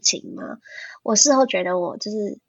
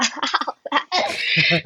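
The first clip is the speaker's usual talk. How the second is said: higher-pitched laugh, rising at the end; 6 semitones higher than usual. Real, not acted. Hosts joking as the episode ends, amused laugh. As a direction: laugh through the wrap-up. Laughing while wrapping up the show.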